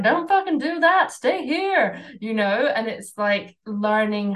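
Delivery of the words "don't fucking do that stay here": high, snapping voice of fear; the unregulated, fear-based self snapping at her, in a high mock panic. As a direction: bark it like your panicked inner voice. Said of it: "unregulated fear-based me was like".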